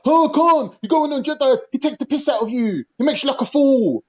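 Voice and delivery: high-pitched mocking chorus of critics; imitated critics. Panellist predicts what others will say to him, mimicking high. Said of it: "They're gonna be like".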